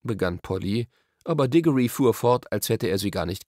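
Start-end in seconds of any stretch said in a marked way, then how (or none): none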